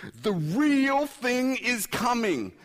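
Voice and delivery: high, loud, stretched-out shout; eager anticipation. Acted. Preacher voicing martyrs awaiting the real thing, eager. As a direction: shout it slowly with mounting anticipation. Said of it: "It's a different kind of how long".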